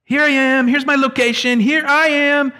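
high, bright chirpy announcing of where he is; playful high-pitched here I am; mock location ping. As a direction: pitch it up like calling out your location. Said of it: "kinda sounds like Isaiah's kinda saying".

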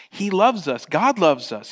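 ordinary talk

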